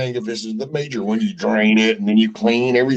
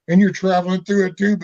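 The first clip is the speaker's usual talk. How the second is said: normal talking voice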